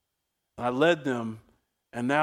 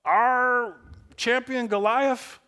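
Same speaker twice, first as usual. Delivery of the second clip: much higher, slowing to a drawn-out finish; mock warlords; 10 semitones higher, 3 dB louder than usual. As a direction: play the indignant Philistine warlords asking in disbelief. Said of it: "warlords are like".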